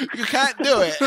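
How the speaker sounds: high mock-British voice, amused; British accent. British friend dismissing his attempt at British, imitated British accent. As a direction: imitate a Brit dismissing you. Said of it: "I had one British friend".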